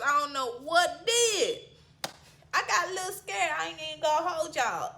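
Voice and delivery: usual speaking voice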